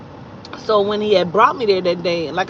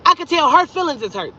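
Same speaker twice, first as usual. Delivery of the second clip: usual speaking voice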